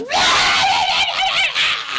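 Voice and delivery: shrieking high scream-laugh; Boo scream. Retold or imitated. Imitating Boo's wordless scream for the cohosts, high shrieking. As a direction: let out Boo's wild shriek. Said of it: "just stops talking entirely and goes".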